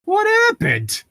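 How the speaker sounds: high squeaky dumb "What happened?"; mock her clueless line in a falsetto; mock dazed squeak. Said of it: "more impactful than".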